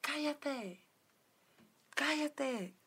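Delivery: half-acted yell at a crying kid to shut up; retold yelling. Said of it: "le empiezo a gritar". (grito imitado, más agudo)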